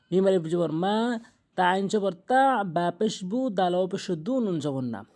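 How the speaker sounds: normal talking voice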